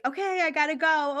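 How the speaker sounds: lightly imitating a hurried brush-off. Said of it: "I was being very like".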